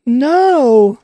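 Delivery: sudden loud high yell of dismay; shout. Real, not acted. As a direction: shout it out, caught off guard. Sudden outburst during gameplay.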